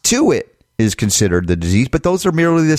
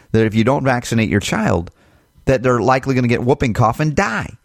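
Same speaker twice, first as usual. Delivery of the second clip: ordinary delivery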